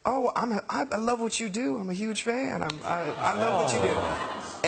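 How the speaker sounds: high, gushing pop-star impression; [name]. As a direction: imitate a sweet, high-voiced admirer. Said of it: "And he was like".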